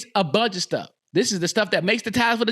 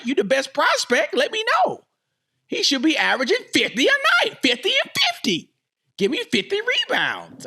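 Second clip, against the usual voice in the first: high, heated ranting; exasperated fan rant going high; worked-up demand; 9 semitones higher than usual. Real, not acted. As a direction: pile on the demands, pitch way up.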